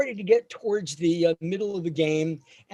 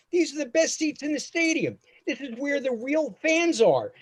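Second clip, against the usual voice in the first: dad voice pitched higher, insisting; mock-proud father, a step higher; proud dad, raised pitch; 9 semitones higher than usual. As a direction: sell the cheap seats as the best, higher. Dad boasting about high seats.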